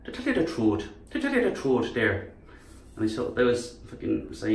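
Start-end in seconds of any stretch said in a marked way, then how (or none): 0.0-2.3 soft-spoken Irish lilt; Irish accent. Shown as a demonstration. Speaker showing Irish sounds pretty, Irish accent. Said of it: "even Irish can sound pretty".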